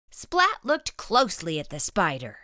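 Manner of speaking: usual speaking voice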